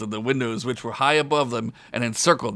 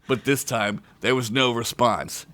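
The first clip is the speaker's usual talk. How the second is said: plain reading voice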